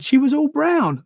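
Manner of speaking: put-on high, slow small child's voice; a little boy reporting what he saw; imitating his son. Dad retelling his son's words: high, slow child's voice. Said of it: "he said".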